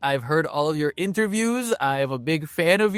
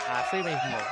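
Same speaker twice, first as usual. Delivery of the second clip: ordinary talk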